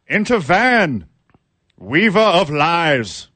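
high, loud, slow proclamation; town crier. Pretend.

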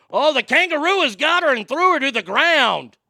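excited commentary. Acted. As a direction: shout the action as it happens. Commentating kangaroo attack.

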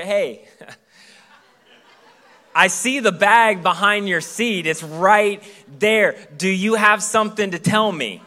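slow, loud laughing tease; husband laughing as he catches his wife's mistake; teasing laugh. Retold or imitated. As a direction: laugh while catching her out. Teasing wife about the bag.

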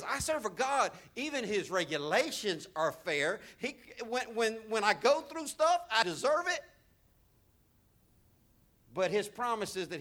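loud, high declaration of faith; confident declaration. Retold or imitated. Pastor showing the believer's confession, loud and sure. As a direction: proclaim it like a creed. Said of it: "remind yourself".

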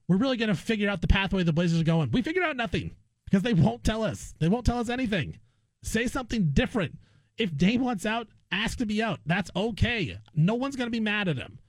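ordinary talk

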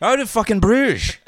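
high, loud Irish outburst; Colin Farrell, Irish accent. Retold or imitated. Co-host quoting the film In Bruges, loud Irish accent. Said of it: "With Colin Farrell?".

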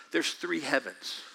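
normal talking voice

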